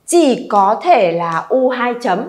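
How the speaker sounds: usual speaking voice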